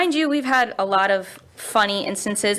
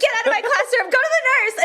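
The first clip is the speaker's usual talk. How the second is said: high frantic shooing; panicked order; faster than usual. Retold or imitated. Squeamish teacher ordering the kid to the nurse, frantic.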